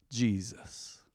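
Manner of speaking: ordinary talk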